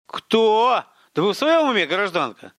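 high, loud scolding disbelief; an officer's loud indignant rebuke; indignant outburst. A narrator's reading. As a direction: snap at her in outrage. Colonel scolds the woman.

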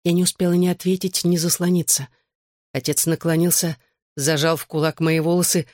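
plain reading voice

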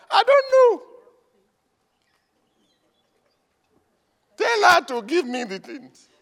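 high, loud squeaky wife; mock wife voice. Wife answers pastor in a high mock voice.

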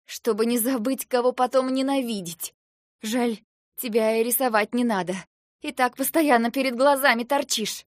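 loud, high bitter retort; snapping back. A narrator's reading. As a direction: snap at him with open hostility. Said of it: "огрызнулась я".

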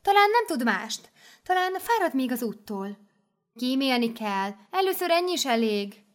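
high, fussy nun voices; nuns objecting. Acted. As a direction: try to spare her, a little primly. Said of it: "Néhány apáca azt mondta".

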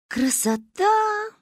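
slow, high, dreamy drawl; drawn out. A narrator's reading. Girl drawls in admiration at the town. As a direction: stretch the word out admiringly. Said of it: "протянула я".

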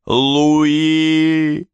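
high desperate scream of a name; frantic scream. A narrator's reading. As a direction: cry out the name beside yourself. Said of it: "вне себя закричал я".

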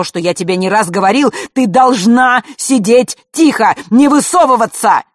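loud, high, furious lecture; angry scolding. A narrator's reading. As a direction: tear into her, rising to a shout. Father scolds daughter. (отец гневно отчитывает дочь, переходя на крик)

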